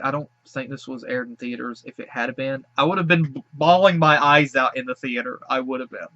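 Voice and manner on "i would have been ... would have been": louder tearful voice breaking through the words; choked-up real crying while talking. Real, not acted. Reacting to a sweet animal cartoon, real tearful crying. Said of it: "I apologize again for crying".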